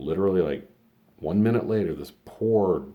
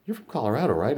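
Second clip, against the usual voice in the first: much higher, louder prying question; lightly voiced gossiping customer; nosy lady; 9 semitones higher, 6 dB louder, faster than usual. Theater customer quizzes him about his home state, high and prying. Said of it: "She comes in, she goes".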